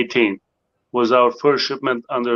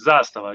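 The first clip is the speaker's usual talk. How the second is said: "Zastava" as ZAHS-tuh-vuh; stress on first syllable; 2 semitones lower than usual. Shown as a demonstration. Guest explains that it is easy, first-syllable stress on "Zastava". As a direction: lean on the first part of "Zastava", let the rest drop. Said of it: "stress on the first part".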